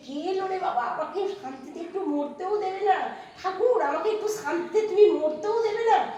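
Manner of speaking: high, loud wail of a despairing mother; desperate mother. Acted. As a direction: cry out, at the end of her rope. Desperate mother voiced in story.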